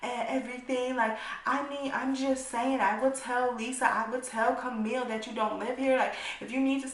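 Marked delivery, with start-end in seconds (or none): 0.0-1.0 high, sing-song mock of a friend; lightly voiced friend, mocking; mocking her words. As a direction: parrot her offer in a put-on higher voice. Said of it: "You said".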